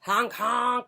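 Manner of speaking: playful traffic honks; car horn honks. Acted. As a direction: imitate passing car horns. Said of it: "kept speeding by and honking".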